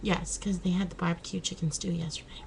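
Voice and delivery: whispered aside. Real, not acted. Telling husband what they ate yesterday, whispered. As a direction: breathe it out in a whisper.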